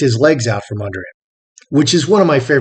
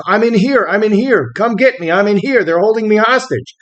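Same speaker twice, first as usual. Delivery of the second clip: re-enacted call for help, pitched higher; 6 semitones higher than usual. Held captive, to the approaching agent, mildly calling out for help. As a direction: call out for rescue without really shouting. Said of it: "I start calling out".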